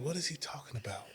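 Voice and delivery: soft, bewildered mumble; confused undertone. Retold or imitated. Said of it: "I'm like".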